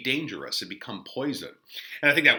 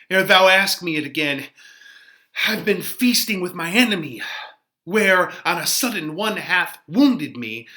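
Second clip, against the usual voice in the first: put-on eager, louder young lover's verse; young Romeo; 3 semitones higher, 5 dB louder than usual. Teacher voicing Romeo answering the friar, eager and bright. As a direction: brush off the old love with a lift.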